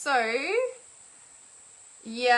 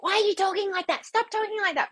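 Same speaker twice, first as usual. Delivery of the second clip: high-pitched mock irritated snapback; fired up; 10 semitones higher, 3 dB louder than usual. Someone objecting to a calmly set boundary, mock fired-up. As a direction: imitate someone bristling at being corrected. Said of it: "some people get really fired up".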